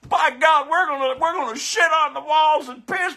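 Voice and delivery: high, loud mock Trumper rant; hammed-up MAGA rant, high and loud. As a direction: ham up an outraged Trumper, voice high and shouting. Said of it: "you want him back so bad".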